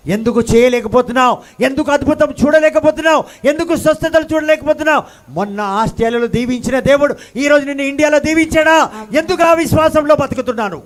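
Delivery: preacher pressing the congregation with rising fervour; fiery preaching. Acted. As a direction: drive each question harder at the congregation. Preacher builds to full force.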